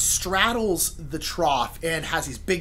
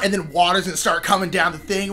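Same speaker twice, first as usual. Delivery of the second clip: usual speaking voice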